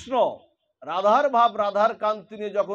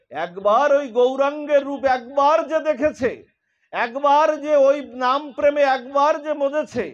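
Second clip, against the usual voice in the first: higher and louder devotional build; fervent preaching; 5 semitones higher, 5 dB louder than usual. Acted. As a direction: lift the congregation with each একবার. Preacher rising to fervour. (উচ্চস্বরে ভক্তিভরে প্রবচন)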